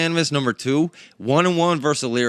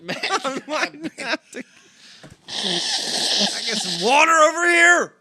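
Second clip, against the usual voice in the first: laughing; slower than usual. Real, not acted. Still laughing, dragging words.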